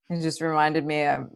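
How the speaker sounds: ordinary talk, nothing marked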